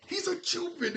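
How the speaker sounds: "He's a Cupid." jumped way up in pitch on "a"; squeaky high repeat. Shown as a demonstration. Vlogger repeating his Cupid theory to viewers in a high squeak. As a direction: squeak it high as a playful echo. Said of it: "I'm telling you".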